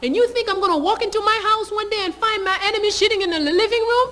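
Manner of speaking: a general shouting down a defiant bride; furious general. Retold or imitated. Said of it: "He was furious".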